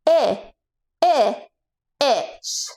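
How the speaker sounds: I, I, itch with the short i of itch; short i sound. Shown as a demonstration. Coach sounding out itch for kids. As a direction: stretch I, I before itch. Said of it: "give me an I".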